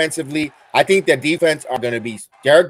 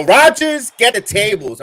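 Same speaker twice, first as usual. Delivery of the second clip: mock call-out; 6 semitones higher, 4 dB louder than usual. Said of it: "I'm gonna go".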